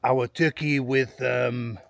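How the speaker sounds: lightly put-on French accent, slow; mock French accent. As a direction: tease the French viewers in a hammed-up French accent. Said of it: "know what your French people are gonna say".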